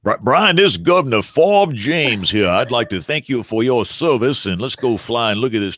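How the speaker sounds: a hint of a Southern politician; Southern governor impression. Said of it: "all the Alabama governors sound like Foghorn Leghorn".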